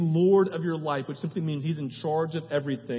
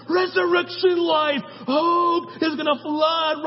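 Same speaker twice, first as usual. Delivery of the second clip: much higher, louder, slow triumphant shout; excited proclamation; 8 dB louder, slower than usual. Retold or imitated. Jesus proclaiming resurrection hope, slow triumphant shout. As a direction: declare it like a flood breaking loose. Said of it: "he's like".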